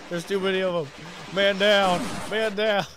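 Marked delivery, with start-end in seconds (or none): 1.3-2.9 loud mock squad callout; calling out. Real, not acted. Gamer jokingly calls his own death, loud. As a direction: bark it like a soldier going down.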